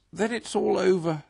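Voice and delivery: understated sob, high and loud; sobbing. A narrator's reading. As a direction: despair into the cushions, mildly tearful. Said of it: "sobbed the Toad".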